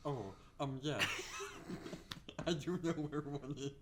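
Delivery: alien voice. Acted. Enderman answering about the fortress, laughing alien voice. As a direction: try an alien voice while cracking up. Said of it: "Talk it more like an alien".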